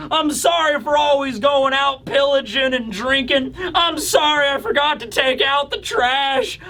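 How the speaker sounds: high, whiny apology to mother; mock pleading son. Giant warriors apologising to their mothers, high mock wail. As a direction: overgrown boy begging forgiveness, hammed up. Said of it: "flashing back to their own childhood".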